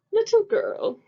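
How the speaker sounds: haughty archduke. Acted. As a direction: play the pompous archduke looking down on her. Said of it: "said the archduke".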